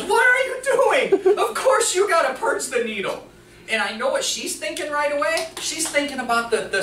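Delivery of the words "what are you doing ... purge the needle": high, loud exasperated scolding; head nurse scolding. Retold or imitated. Head nurse scolding the new nurse, high and loud. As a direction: lay into him for the mistake. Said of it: "She turns to him, she goes".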